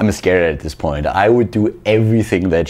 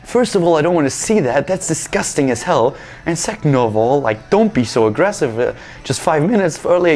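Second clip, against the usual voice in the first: higher, pleading exasperation all through; exasperated protest; 5 semitones higher than usual. Retold or imitated. Patient begging the doctor to stop showing him, exasperated. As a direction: plead with her to calm down. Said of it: "And I'm like".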